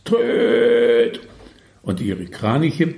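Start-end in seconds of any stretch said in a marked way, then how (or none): 0.0-1.2 long held, trumpet-like toot; drawn-out honking "tröööt"; trumpeting crane honk. A narrator's reading. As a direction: imitate a crane's trumpet call. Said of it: "Ein trompetenartiges Tröten des Kapos".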